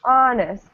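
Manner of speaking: "Honest" with a strong first syllable, falling away; stress at the beginning. Shown as a demonstration. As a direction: lean on "Hon", let the end of "Honest" drop. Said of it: "The stress is at the beginning".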